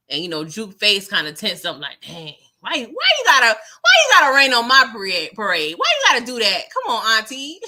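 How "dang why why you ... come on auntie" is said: whiny complaint. Retold or imitated. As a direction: complain like a sulky teen. Juke complaining to her auntie.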